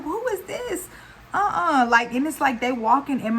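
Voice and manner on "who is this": alarmed high question. Retold or imitated. Stranger chasing them at 1am.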